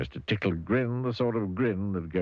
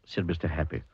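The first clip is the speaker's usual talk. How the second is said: plain reading voice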